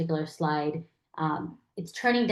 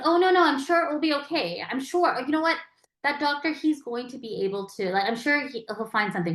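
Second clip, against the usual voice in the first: higher-pitched, hurried-in reassurance; oh-no-no comforting, pitched up; falsely hopeful reassurance; 7 semitones higher than usual. Acted. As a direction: gush false hope to soothe someone. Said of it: "give false hope".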